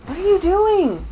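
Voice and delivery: high-pitched call to a misbehaving cat; scolding call to pet. Real, not acted. Owner catches cat climbing the wardrobe, high-pitched scolding call.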